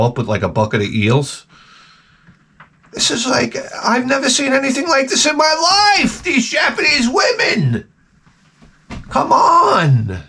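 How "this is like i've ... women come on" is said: shouting in wide-eyed amazement; amazed shout. Retold or imitated. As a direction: blurt it out like you can't believe your eyes. Amazed at Japanese women.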